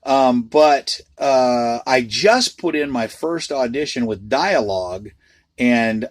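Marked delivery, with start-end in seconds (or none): none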